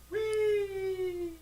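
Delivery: high, gleeful squeal of a jump; gleeful whee. Pretend. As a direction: squeal it like leaping off a cliff. Host mocks diving off cliff.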